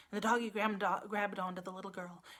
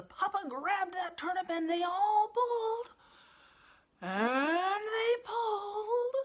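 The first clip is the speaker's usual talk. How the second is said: high, loud, slow heaving; climactic strain; 11 semitones higher, 10 dB louder, slower than usual. A narrator's reading. Narrator building the final pull, high and loud. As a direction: pull with everything you've got.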